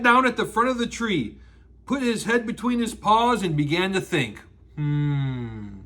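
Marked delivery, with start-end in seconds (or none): 4.8-5.8 low, soft thoughtful hmm; thinking hum. Acted. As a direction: hum like a pondering bear. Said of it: "Winnie the Pooh was thinking like this".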